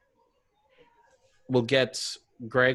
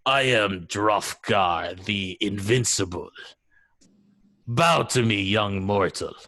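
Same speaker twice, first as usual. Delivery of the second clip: Drofgar the Invincible; 5 semitones lower than usual. Shown as a demonstration. Game master showing off an NPC, mighty conqueror voice. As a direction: intone it deep and imperious. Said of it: "I'm trying to go from".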